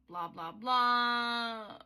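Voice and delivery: slow, loud, drawn-out wail of boredom; dismissive blah. Real, not acted. Skipping backstory for viewers, loud drawn-out dismissive wail. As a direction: groan it out dramatically.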